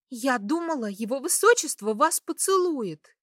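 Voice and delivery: high, lively, eager outburst; excited exclamation. A narrator's reading. Henrietta gossiping to her mistress about the prince, excitedly. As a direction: burst out with it, pitch jumping up. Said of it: "возбуждённо воскликнула она".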